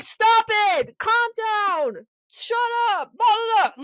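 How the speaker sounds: high, loud mock yells; mock shouting crowd. Yoga class shouting at the fighters, mock shouting. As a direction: shout down a brawl, hammed-up. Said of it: "People like".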